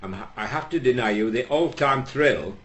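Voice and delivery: ordinary delivery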